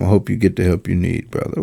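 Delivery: low, quick, softened mimicry of solemnity; mock sullen. Commentator repeating the athlete's parting words, low mock-sullen voice. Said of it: "to a sullen".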